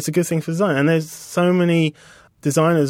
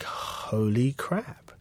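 softer, very slow, drawn-out awe; hushed slow holy crap; 8 dB quieter, much slower than usual. Retold or imitated. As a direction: let it out slowly under the breath. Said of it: "I'm like".

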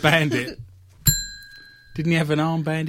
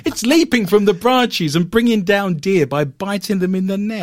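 higher, dramatic British newsreel voice; old English British accent; 4 semitones higher than usual. Acted. Host describes squirrels biting deer, mock old British newsreel accent. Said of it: "I'm gonna do this in an old English British accent".

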